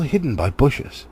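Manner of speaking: ordinary delivery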